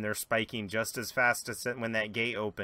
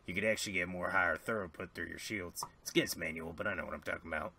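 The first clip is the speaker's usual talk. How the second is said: confiding engineer; 5 semitones lower, 6 dB quieter than usual. Acted. Jedediah confides to the alien.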